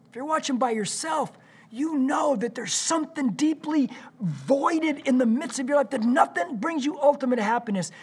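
impassioned high, loud altar call; preaching. Acted. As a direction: preach it out, pleading. Altar call at full force.

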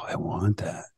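low, hushed wanting; tempted. Retold or imitated. Homeowner tempted by an offer, low and hushed. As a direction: drop it right down, soft and covetous.

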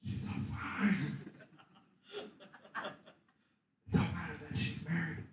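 very soft tempter's whisper; hushed, insinuating whisper with long pauses; whispering devil. Acted. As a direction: whisper it like a sly temptation in someone's ear. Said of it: "he likes to walk up and he likes to go".

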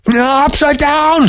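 slow, very high squeal; outraged little creature shriek; screeching weasel. A narrator's reading. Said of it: "screeched Weasel".

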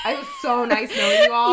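high, loud, laughing goodbye; hammed-up doom farewell; laughing mock farewell. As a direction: shout a dramatic farewell through laughter. Joking farewell before doom.

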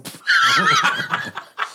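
long, high-pitched laughing fit; real high laugh. Real, not acted. Laughing after cursing Evan, high real laugh.

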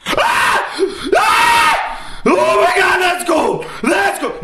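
high shocked shrieks at cold water; laughing shriek, jumped in ice cold water. Retold or imitated. Jumping into cold pool, mocked.

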